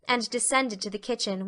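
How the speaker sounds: ordinary delivery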